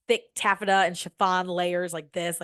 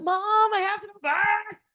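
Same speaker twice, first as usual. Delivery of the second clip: high whiny begging; high-pitched plea to go back; pleading kid; 13 semitones higher, slower than usual. Retold or imitated. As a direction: beg mom like a desperate teen.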